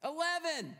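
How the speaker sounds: high, loud hopeful shout of a number; acted newcomer's yell in a joke; calling out. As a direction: give it a try, calling it out loud. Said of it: "we just call out the number".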